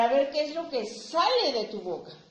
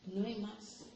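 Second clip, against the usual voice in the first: No hay más repeated much softer, almost to oneself; soft echo of No hay más; echoed quietly; 2 semitones lower, 9 dB quieter, slower than usual. Shown as a demonstration. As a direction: let No hay más fall away softly.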